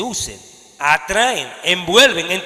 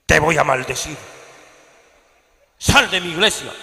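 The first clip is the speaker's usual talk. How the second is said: pastor que maldice; 2 semitones lower than usual. Acted. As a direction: threaten them like a false apostle. Voicing a cursing false pastor. (pastor que amenaza con voz grave)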